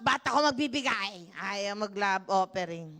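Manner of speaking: mock stingy churchgoer. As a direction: sulk like someone who won't give.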